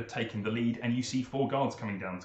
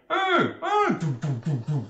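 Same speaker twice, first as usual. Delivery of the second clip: two high, loud hoots, then quick thuds; imitated noises from inside; 4 semitones higher, 5 dB louder than usual. Game master imitating noises behind the door, high then thudding. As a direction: voice muffled cries and rapid thumping. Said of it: "you hear very quickly a".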